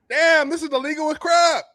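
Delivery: high, loud mock disbelief; retold incredulity. Recalling his reaction in the meeting, high-pitched incredulity. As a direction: pitch way up as you replay your outrage.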